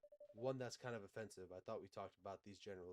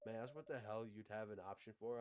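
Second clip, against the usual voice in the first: usual speaking voice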